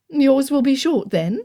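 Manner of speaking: aunt pressing Darcy; aunt inquiring. A narrator's reading. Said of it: "his aunt inquired".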